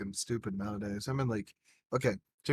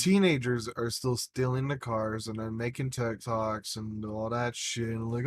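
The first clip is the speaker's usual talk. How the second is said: usual speaking voice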